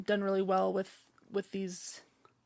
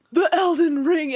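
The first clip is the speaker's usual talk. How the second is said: loud, higher-pitched mock narrator; Elden Ring narrator; 7 semitones higher, 8 dB louder than usual. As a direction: proclaim it like a grand game intro. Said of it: "that guy that's like".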